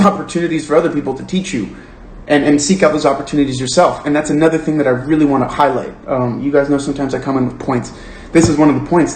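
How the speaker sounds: usual speaking voice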